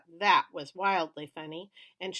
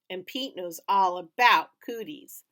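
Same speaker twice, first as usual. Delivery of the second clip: teasing; 8 dB louder than usual. A narrator's reading. Little brother teasing loudly.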